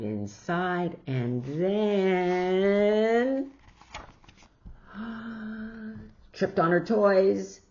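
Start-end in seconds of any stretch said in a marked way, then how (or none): 4.6-6.1 gasp. A narrator's reading. Jillian trips on toys.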